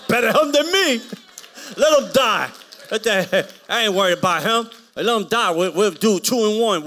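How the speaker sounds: high, loud, laughing pulpit shout; roaring, laughing preacher cry; shouted preaching. Real, not acted. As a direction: shout it out over the crowd.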